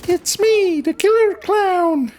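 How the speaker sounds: high squeaky clown, slow at the end; killer clown voice. Acted. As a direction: announce yourself like a creepy clown.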